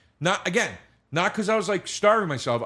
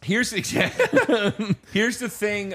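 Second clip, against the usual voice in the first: laughing; 7 semitones higher than usual. Real, not acted. Laughing through the words.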